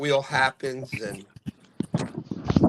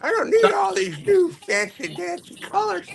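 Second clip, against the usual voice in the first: put-on high, creaky old-timer grumble; old man voice. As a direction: play a cranky old man. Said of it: "that works really good for my old man voice".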